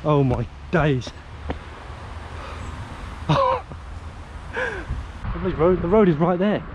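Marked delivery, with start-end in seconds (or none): 1.3-5.4 laugh. Real, not acted. Shocked at the steep drop.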